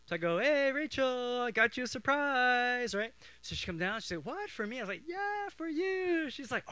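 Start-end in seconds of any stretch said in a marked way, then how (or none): 0.4-3.0 high, slow singsong call; mock-sweet call to lure a sister; sing-song luring. Brother luring sister with a surprise. 4.2-4.8 high-pitched little sister voice; mock surprised sister. 5.0-6.3 very high, drawn-out coaxing; mock-sweet luring. Brother luring sister on.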